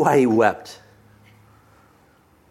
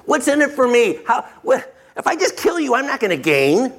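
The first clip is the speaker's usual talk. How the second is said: higher-pitched mock scheming; self-serving brother; 7 semitones higher than usual. Preacher mimicking Joseph's brother, self-serving. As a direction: play the brother weighing up his own gain, pitched up. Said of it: "what his brother Reuben did".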